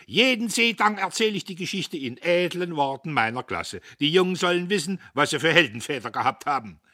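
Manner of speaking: high, loud, excitable storyteller; puffed-up professor, shrill with excitement; boastful old professor. A narrator's reading. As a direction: crow the war tale at a high pitch. Professor Kühnchen boasts to company.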